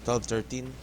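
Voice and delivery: ordinary talk, nothing marked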